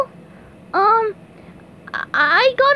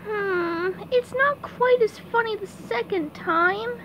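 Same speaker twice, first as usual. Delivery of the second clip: deflated, quieter; 2 semitones lower, 8 dB quieter than usual. Real, not acted. Hiccups stop being funny.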